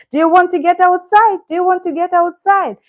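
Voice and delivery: high cooing question. Real, not acted. Owner asking her cat if he wants out, high baby talk. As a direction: ask the cat in a high sing-song. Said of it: "that's how we are talking".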